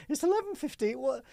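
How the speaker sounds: retold shock at a late-night call; incredulous squeak. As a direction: squeak it out in disbelief. Woken late by client's call.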